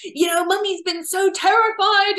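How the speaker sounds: high, loud frightened scolding; scolding parent. Retold or imitated. Parent scolding child back from the park, panicked yell. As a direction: snap at the lost child in panic. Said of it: "We go".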